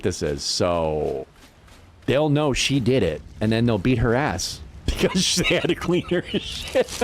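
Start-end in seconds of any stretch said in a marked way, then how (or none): 4.9-7.0 words breaking into real laughter; laughing mid-sentence. Real, not acted. Explaining the inmates' revenge to a friend, laughing. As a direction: let laughter take over the sentence.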